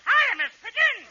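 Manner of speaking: put-on squeaky high little-boy greeting. Shown as a demonstration. Kid remembers to greet Miss Pigeon in a high little-boy voice. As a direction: pitch it way up like a small child. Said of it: "Oh, high voice".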